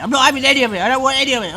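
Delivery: put-on loud, high, sulky child refusing; angry refusal. Parent voicing Peter refusing his favourite food, angry shout. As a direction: shout the refusal like a spoilt boy.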